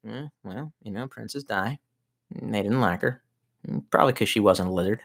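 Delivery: imitated low, quiet mumble; hushed deadpan mimicry; soft-spoken boss. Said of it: "he was very soft-spoken".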